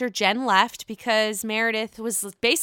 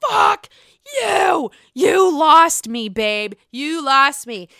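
louder, higher mock yell; Heather yelling; 5 semitones higher, 7 dB louder, slower than usual. Heather cursing out Whitney, a louder imitated yell. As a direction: hurl it back at her. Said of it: "Heather was just like".

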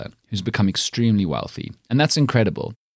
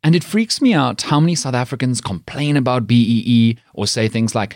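ordinary delivery

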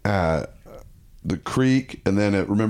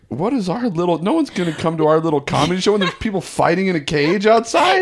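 high-pitched incredulous rant, building louder; exasperated disbelief; 8 semitones higher, 3 dB louder than usual. Retold or imitated. Comic moaning about a cage fight outside his show, exasperated. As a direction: protest as if the gig is doomed.

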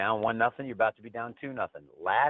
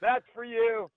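much higher, louder goofy sign-off voice; hammed sign-off; 5 dB louder than usual. Host signing off to his listeners, in a goofy much higher voice. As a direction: sign off in a silly cartoonish voice.

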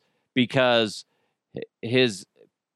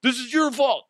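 mock blaming; 10 semitones higher than usual. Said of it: "and I'm like".

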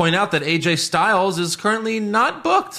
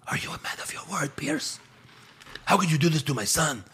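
whisper yelling; 7 dB quieter than usual. Retold or imitated. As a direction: hiss the words urgently under breath. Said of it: "Rey Mysterio whisper yells at Adam Pearce".

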